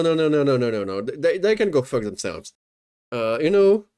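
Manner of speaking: normal talking voice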